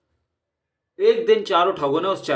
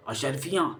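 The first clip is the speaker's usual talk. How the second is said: greedy murmur; 7 dB quieter than usual. Acted. Thugs murmur on hearing gold.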